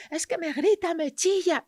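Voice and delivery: high, loud mock complaint of a shouting child; mock shouting parent. Mother imitating a screaming child, loud. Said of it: "yo siempre digo".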